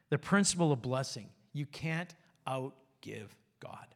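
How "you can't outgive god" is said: slow, near-whispered conclusion; hushed refrain. Real, not acted. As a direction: drop very soft and low, word by word. Hushed refrain closing the principles.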